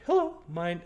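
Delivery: Hello, my pitched high and loud. Shown as a demonstration. GM retrying the voice for the wood elf Dara, high and loud. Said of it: "what was her voice?".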